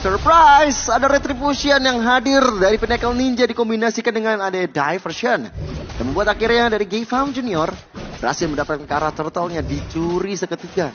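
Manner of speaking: loud high excited recap; esports caster. Acted. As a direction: ride the hype of the steal. Co-caster follows hype. (komentator esports yang bersemangat)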